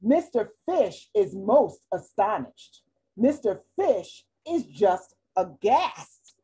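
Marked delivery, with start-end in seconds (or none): none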